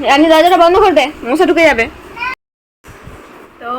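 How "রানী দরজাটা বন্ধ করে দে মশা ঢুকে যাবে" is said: loud, raised-pitch call; real shout to someone at home. Real, not acted. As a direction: call out an order across the house. Calls Rani to shut the door.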